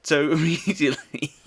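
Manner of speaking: ordinary talk, nothing marked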